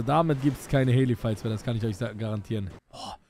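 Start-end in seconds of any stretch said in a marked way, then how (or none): none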